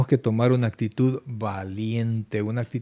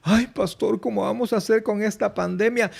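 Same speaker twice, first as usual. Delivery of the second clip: a lightly voiced, higher whiny "Ay, pastor"; worried congregant; 7 semitones higher than usual. Pastor mimicking a fretful member, light whine. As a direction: mimic a fretting churchgoer. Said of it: "imitating a worried congregant".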